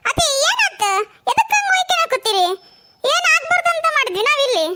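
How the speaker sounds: affronted high-pitched outcry; indignant protest. Acted. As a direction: pitch right up, demanding what's wrong. Startled by mother-in-law's accusation.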